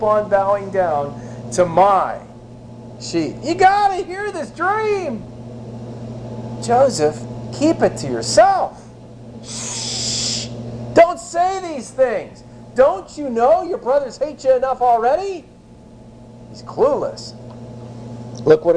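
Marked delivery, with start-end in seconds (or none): 3.4-5.2 high, slow, gushing boast; eager young Joseph. Acted. Joseph pestering his brothers about his dream, high and eager. 6.6-15.4 high, slow, hushing caution; hushed warning. Acted. Someone hushing Joseph.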